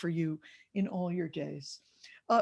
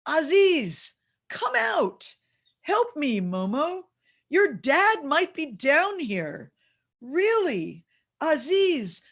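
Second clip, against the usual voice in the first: put-on high, loud child calling into the ground; little kid's earnest call, drawn out; grandson calling out; 5 semitones higher, 5 dB louder, slower than usual. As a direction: imitate a small boy summoning someone, slow and swooping. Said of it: "this is what he said".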